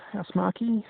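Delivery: Housemarque as "house-mar-kee", pitched high and rising; unsure pronunciation. Shown as a demonstration. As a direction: try Housemarque with a questioning "kee" ending. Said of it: "I don't know how it would be pronounced".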